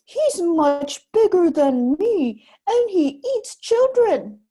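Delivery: slow, loud, high spooky warning; giant woman warning. Acted. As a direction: scare the boy with a looming threat. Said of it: "she told Jack".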